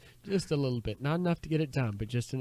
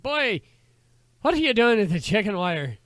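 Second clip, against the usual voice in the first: put-on high, loud Scottish-accented holler at the boy; Scottish uncle; 10 semitones higher, 11 dB louder than usual. Uncle challenging the boy by the river, Scottish shout. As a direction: joke uncle yelling in a hammy Scottish accent. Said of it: "Now he's half Scottish".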